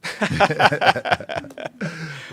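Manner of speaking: big loud laugh at his own punchline. Real, not acted. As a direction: burst out laughing.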